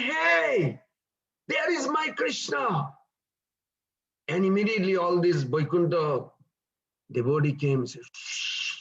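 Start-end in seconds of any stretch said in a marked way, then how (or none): 0.0-2.9 excited call. Acted. Boy calls out on seeing Krishna. 8.1-8.7 a sharp whispered shh; hushing shh. Acted. Devotees hush the boy.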